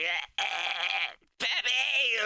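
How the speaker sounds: high, strained call for the teacher; kid Fox calls for help. Retold or imitated. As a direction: squeak out for help like a little kid.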